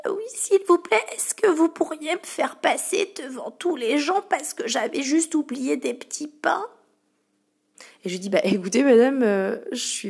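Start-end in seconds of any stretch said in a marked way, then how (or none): 0.1-6.6 customer begging. Retold or imitated. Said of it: "en me disant".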